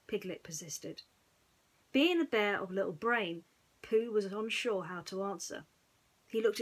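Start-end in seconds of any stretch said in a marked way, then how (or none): none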